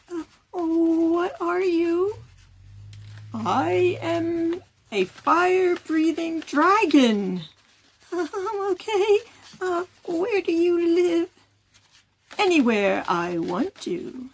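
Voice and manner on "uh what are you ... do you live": interview voice. Shown as a demonstration. Puppet interview demo.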